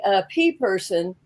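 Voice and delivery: usual speaking voice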